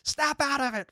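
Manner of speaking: high-pitched imitated reprimand; mock scolding. Movie fan acts out slapping the crier, mock scolding. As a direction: imitate snapping someone out of hysterics.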